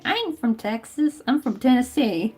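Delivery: a light put-on southern accent. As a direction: drawl it like a mock Texan. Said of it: "I mustered up in my most southern accent".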